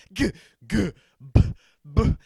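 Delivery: mock angry consonant grunts. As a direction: sputter like an irritated Klingon. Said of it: "I sounded like an irritated Klingon".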